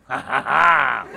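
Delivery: slow goofy put-on laugh, trailing into breath; Bobby. Camper challenged to find his pastor, goofy laugh. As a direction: mimic a dopey, scheming laugh. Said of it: "Bobby's like".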